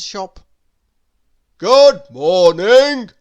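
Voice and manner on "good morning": slow, very loud grocer's bellow; booming, drawn-out shout. A narrator's reading. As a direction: bellow it out slowly across the shop. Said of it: "bellowed the grocer".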